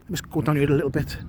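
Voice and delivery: usual speaking voice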